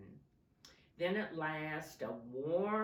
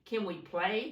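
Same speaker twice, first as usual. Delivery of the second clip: louder, higher call from afar; 4 semitones higher, 8 dB louder than usual. A narrator's reading. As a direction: call to get their attention. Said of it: "called two fox cubs".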